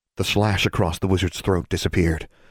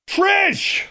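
very high, full yell of a name; 4 dB louder than usual. A narrator's reading. Heroine calls to girl fallen off the cliff, yelling. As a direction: yell far down into the distance. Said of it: "Alexandra yelled".

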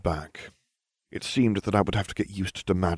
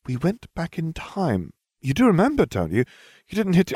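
careful Hermione; 10 semitones higher than usual. Acted. Hermione checks carefully whether Harry remembers, higher and careful. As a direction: pick each word with care. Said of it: "said carefully".

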